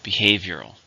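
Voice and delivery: "Behavioral" with a loud HAVE; stress on second syllable. Shown as a demonstration. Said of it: "Stress is still on the second".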